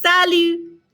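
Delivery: high, held, sing-out classroom French; repeat-after-me model. Shown as a demonstration. Teacher drills greetings with the class, high and measured. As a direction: lift each word high for learners to copy. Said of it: "Répétez".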